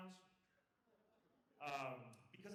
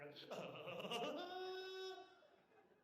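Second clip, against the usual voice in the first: high, long-held whooping laugh; cicada laugh; 13 semitones higher, 4 dB louder than usual. Shown as a demonstration. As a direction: laugh that climbs into a sustained cicada-like squeal. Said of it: "you're gonna have a chicharra laugh".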